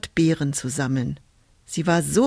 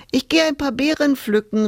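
higher-pitched call to mother; lightly called out, raised pitch; 8 semitones higher than usual. A narrator's reading. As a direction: call it out brightly as you leave. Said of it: "rief sie der Mutter zu".

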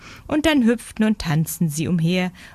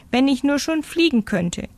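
kleine Meise, sehnsüchtig; 9 semitones higher than usual. Acted.

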